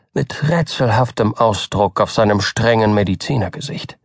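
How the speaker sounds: usual speaking voice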